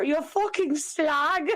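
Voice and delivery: slow, overly proper British curse; proper English accent. Retold or imitated. Podcaster savoring British cursing, mock-proper English accent. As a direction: swear very properly, drawing out the English vowels. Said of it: "it was so proper".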